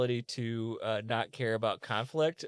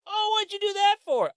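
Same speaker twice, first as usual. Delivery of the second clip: much higher, louder cartoonish victim voice; mock shocked victim; 6 dB louder than usual. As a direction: play the punched guy, pitched way up. Punched victim asks the smoker.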